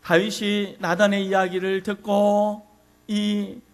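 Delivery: normal talking voice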